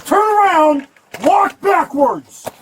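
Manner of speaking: high commanding shouts; shouted surrender demands. Real, not acted. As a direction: bark each command. Officer orders suspect at gunpoint.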